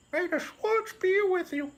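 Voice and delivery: very high, put-on old sage voice; Yogurt blessing. Yogurt blesses the heroes, very high sage voice. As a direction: bless them like a tiny sage.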